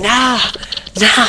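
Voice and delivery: high, desperate protest; frustrated no. Retold or imitated. Punk as the crowd chants, desperate no. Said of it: "C M Punk's like".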